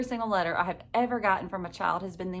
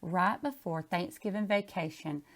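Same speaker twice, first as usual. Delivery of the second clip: plain reading voice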